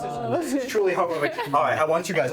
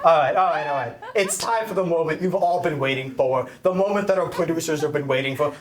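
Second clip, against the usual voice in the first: ordinary talk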